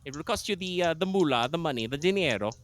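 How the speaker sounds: cartoon Italian accent; hammed-up Italian accent. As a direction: lay the Italian accent on thick. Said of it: "You speak in an Italian accent".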